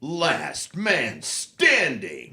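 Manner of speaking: slow, booming game announcer. Pretend. Halo voice actor reciting a medal name, booming announcer.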